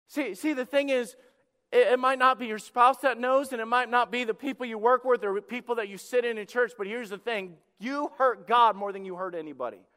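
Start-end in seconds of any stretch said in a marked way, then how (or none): none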